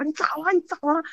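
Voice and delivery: high, hushed mock panic; imitated alarmed dad. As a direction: act out a frightened father's questions. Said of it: "我爸吓得". (模仿爸爸受惊追问)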